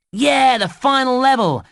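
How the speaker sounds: high, triumphant whoop of words; excited cheer. Acted. Game helper greets the player's final level, excited cheer.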